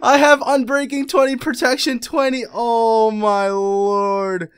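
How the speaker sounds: loud, high wail slowing into a long groan; distraught lament. Real, not acted. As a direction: mourn lost gear, dragging out the last words. Losing expensive gear.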